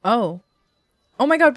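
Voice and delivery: ordinary talk, nothing marked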